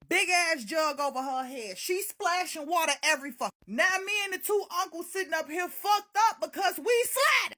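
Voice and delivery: normal talking voice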